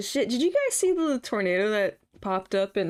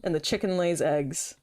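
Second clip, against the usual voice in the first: ordinary talk, nothing marked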